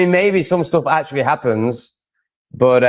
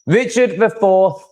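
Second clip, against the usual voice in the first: slow, higher, mock-upper-class English accent; posh English accent; 7 semitones higher, slower than usual. Host admiring a posh streamer, in a light mock posh English accent. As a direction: drawl the name like a posh English toff. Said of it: "has such a nice voice".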